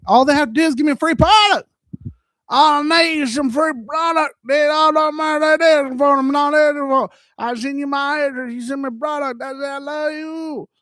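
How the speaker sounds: excited sponsor rant; worked-up high rant. Real, not acted. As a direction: plead loudly for free product. Ranting for free product.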